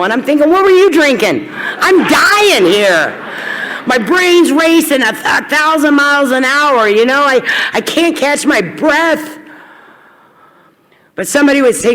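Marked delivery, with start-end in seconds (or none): 0.5-9.4 frantic. Retold or imitated. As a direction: rant it, half laughing. Retold panicked inner thoughts.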